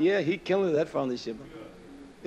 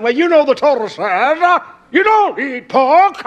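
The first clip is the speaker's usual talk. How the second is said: mock objector; 9 semitones higher, 8 dB louder than usual. Objector lecturing him on what the Torah says, mock scolding. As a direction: mimic a self-righteous objector, loud and high.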